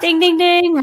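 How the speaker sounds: high, loud quiz-host "ding, ding, ding"; game show ding. Acted. Host announcing the correct trivia answer, excited game-show style. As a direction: ring the right-answer bell.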